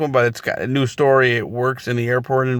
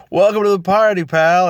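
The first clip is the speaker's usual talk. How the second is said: higher, louder smug quip; cocky one-liner; 7 semitones higher, 4 dB louder than usual. Retold or imitated. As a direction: toss it off like a wisecrack hero. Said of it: "And of course he goes".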